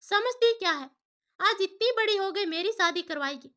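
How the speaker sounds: loud, high furious yelling at her daughter; angry shout. A narrator's reading. Mother yelling at daughter over the marriage, furious shout. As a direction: scream it out in anger. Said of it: "Sukanya जोर से चिल्ला रही थी".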